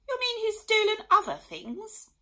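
high, loud dismayed question; aghast royal cry. A narrator's reading. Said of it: "cried the Queen in dismay".

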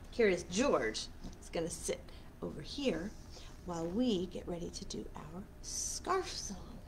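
soft whispered aside. Real, not acted. Librarian whispering to the children before the scarf song, hushed.

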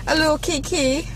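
tossing off a little kiki in a jokey British accent. Shown as a demonstration. To viewers while driving, a playful British accent. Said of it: "That's my, my British accent".

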